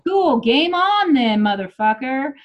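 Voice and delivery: cocky acted comeback; defiant challenge. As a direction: throw down the challenge in a retold argument. Retort to partner in retold fight.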